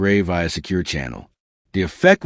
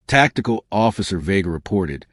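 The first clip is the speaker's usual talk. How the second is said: plain reading voice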